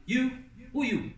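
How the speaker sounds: high, scornful put-down; rude sneer. Shown as a demonstration. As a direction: look him up and down with the word. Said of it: "Like this".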